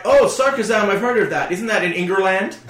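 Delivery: high mock-American accent; caricatured American, naively curious; American gamer. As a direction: imitate a clueless Yank. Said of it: "other sort of Yanks playing".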